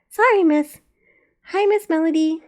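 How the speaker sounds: put-on high, loud small child; little girl voice. A narrator's reading. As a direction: chirp it like a young girl. Little girl Zena speaks.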